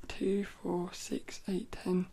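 counting under the breath, softly; whispered count. Real, not acted. Counting the books to herself, hushed. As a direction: murmur the numbers to yourself.

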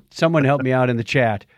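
ordinary delivery

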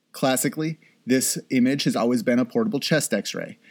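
ordinary talk

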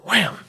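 high, sudden "wham" for a fist hitting; imitated punch. Storyteller imitating a punch on the bathroom door, high "wham". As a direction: punch it out as a sound effect. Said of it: "he punches the bathroom door".

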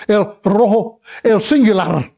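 El rojo, el singular with trilled Rs; El rojo, el singular, in Spanish; rolled Spanish R. Shown as a demonstration. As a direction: roll the R in rojo and at the end of singular. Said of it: "Two R's is a roll".

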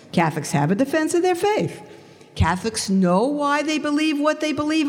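high-pitched, disbelieving question; astonished, pitch held high; acted incredulity. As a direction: echo it back as if unheard of.

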